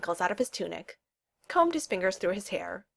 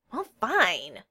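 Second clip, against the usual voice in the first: mildly huffy reply; irritable. A narrator's reading. Snubbed friend gives up over breakfast, mildly irritable. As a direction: give in with a touch of irritation. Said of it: "Esmond said irritably".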